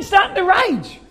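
ordinary delivery

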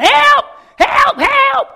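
desperate full-voice screams; screaming for help. Acted. Man hanging from a branch screams for help, desperate shout. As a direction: scream it like a man dangling off a cliff. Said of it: "He starts screaming out".

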